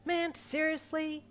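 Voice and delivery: slow, high exasperated whine; fed up, drawn-out complaint. Acted. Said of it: "dropping your angry".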